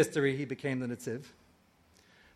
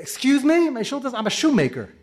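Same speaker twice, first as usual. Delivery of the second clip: bewildered protest; 8 semitones higher, 5 dB louder than usual. Retold or imitated. Netziv's bewildered answer to angel.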